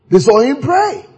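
ordinary talk, nothing marked

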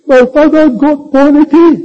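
high-pitched hammed-up sobbing wail; mock crying. Sister crying to her pastor about her father, mock crying. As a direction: imitate a woman crying out her news. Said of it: "she came and she's crying".

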